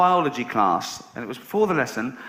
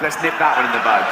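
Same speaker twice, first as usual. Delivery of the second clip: normal talking voice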